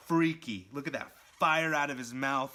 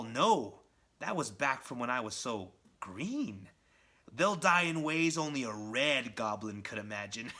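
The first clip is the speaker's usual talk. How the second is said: menacing villain; 2 semitones higher, 3 dB louder than usual. Retold or imitated. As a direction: savor every threat. Villain's ultimatum.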